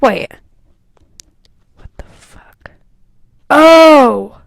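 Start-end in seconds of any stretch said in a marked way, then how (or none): 1.8-2.7 breathless whispered shock; a stunned whisper under the breath. Real, not acted.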